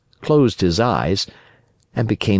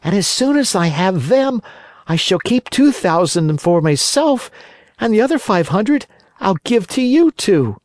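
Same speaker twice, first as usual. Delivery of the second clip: put-on high boyish puppet voice, much higher; Pinocchio voice; 13 semitones higher, 3 dB louder than usual. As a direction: play the wooden boy, frightened and eager. Pinocchio promises.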